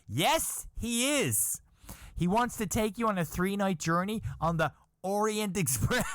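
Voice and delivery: high teasing told-you-so voice; mocking wife.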